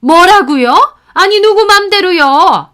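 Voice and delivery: high, loud shocked outcry; indignant shock. Acted. Mother hearing the lease won't renew, loud shocked outcry. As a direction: jump up in outraged disbelief. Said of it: "펄쩍 뛰시더라고요".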